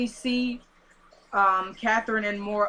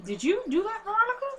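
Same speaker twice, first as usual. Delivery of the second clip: high, lilting accusation with rising end; mock-suspicious question; 13 semitones higher than usual. Husband questioning Veronica about the death, mock-suspicious. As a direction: ask it high and pointed, pitch climbing on the name.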